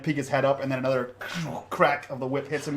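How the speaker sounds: ordinary talk, nothing marked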